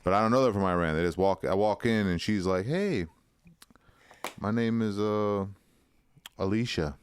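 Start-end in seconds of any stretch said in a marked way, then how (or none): none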